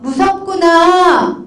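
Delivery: slow, gentle acknowledgement; a drawn-out, understanding "무섭구나"; accepting reply. Shown as a demonstration. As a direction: say it slowly, as if simply accepting the feeling. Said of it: "이러면 뭐라 그러실래요?".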